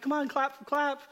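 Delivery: high mock teacher voice urging the class to clap; teacher coaxing the class to clap, high and sing-song. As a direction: chirp it like a teacher coaxing applause. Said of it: "she was like".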